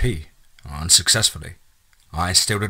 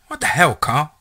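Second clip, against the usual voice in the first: higher-pitched, exasperated outburst; indignant scolding; 8 semitones higher, 4 dB louder than usual. A narrator's reading. Enola has just punched Carl, scolding indignantly. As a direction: tell him off, pitch jumping up.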